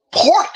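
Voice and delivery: "pork" in a mock regional accent. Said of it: "You know you got an accent".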